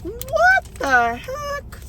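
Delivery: high, slow drawn-out what the heck; viewers' imagined astonished reaction; mock disbelief. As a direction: stretch it in mock shock. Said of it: "And you're like".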